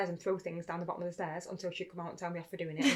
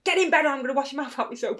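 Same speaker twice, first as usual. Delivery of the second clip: high, loud telling-off; imitated parental outburst; angry mum; 7 semitones higher, 10 dB louder than usual. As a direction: yell a bedtime threat. Mum's threat acted out.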